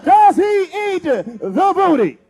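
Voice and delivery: loud, high, measured shout; big showman question. Real, not acted. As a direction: holler it out for the whole room. Poses big question before crowd.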